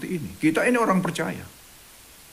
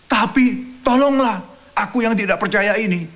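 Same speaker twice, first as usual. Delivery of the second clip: pleading cry; 7 semitones higher, 4 dB louder than usual. Retold or imitated. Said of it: "dia berteriak".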